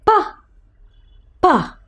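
"pa", "pa" as separate short bursts; phonics sound. Shown as a demonstration. Teacher sounding out lowercase p, short separate "pa" pops. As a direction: pop each "pa" alone, with a gap between. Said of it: "p says".

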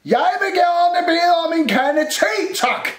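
high, full-voiced holler; loud shout. A narrator's reading. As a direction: shout it as if to someone hard of hearing. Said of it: "råbte Rumle højt ind i skabet". (råber højt)

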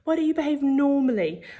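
high-pitched parental reproach; nagging parent. A narrator's reading. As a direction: scold it lightly, high.

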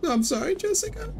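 high, wailing mock sob; fake crying apology. Apology to a game character.

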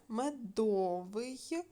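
медовий drawn out slowly on ДО; slow меДОвий, stressed syllable stretched; stress on middle syllable. Shown as a demonstration. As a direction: lean long on the о in медовий. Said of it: "поставте наголос".

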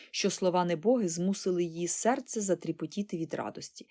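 plain reading voice

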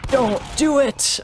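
high, slow pleading yell; pleading shout. Real, not acted. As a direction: beg them not to do it. Pleading with enemy player.

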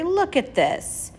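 high, slow cry of surprise; a boy calling the others over, high; Avery calling everyone to look, high and slow; excited cry. A narrator's reading. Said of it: "cried Avery".

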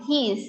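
"his" said as he's, long ee and final S; one drawn syllable, "his" as he's; contracted form. Shown as a demonstration. Teacher showing students the short form of he has, contracted form. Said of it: "He has ย่อเป็น".

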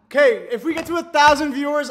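high, loud streamer pitch; excited hype. Real, not acted. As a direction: shout the challenge to the chat. Rallying the livestream chat.

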